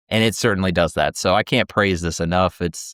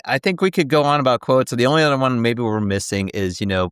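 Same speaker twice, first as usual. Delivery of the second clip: ordinary talk, nothing marked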